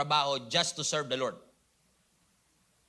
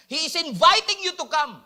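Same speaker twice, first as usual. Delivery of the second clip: raised, high call to the congregation; loud appeal; 9 semitones higher, 9 dB louder than usual. Real, not acted. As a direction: lift the voice and call them to come.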